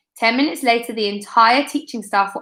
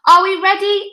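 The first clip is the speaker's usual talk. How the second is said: a higher, louder referee shout; yelled; 9 semitones higher, 5 dB louder than usual. A narrator's reading. A J to the players as referee: higher, louder yell. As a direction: yell it across the field. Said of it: "A J yelled".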